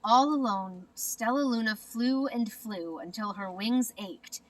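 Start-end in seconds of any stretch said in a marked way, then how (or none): none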